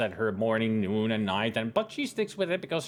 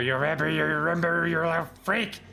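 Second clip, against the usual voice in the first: creepy taunting voice; 3 semitones higher, 3 dB louder than usual. Acted.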